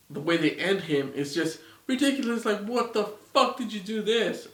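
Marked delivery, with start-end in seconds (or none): 2.4-4.5 higher-pitched, incredulous outburst; exasperated. Real, not acted. Reviewer ranting at filmmakers over the ending, exasperated. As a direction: demand an answer in exasperation.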